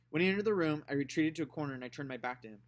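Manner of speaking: ordinary delivery, nothing marked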